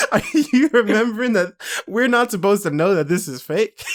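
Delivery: laughing incredulous. Retold or imitated. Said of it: "I'm like".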